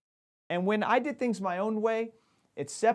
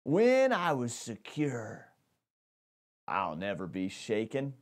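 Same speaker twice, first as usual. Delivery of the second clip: prideful; 7 semitones lower than usual. Shown as a demonstration. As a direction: puff up like a proud man sure nothing can touch him. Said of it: "like a prideful statement".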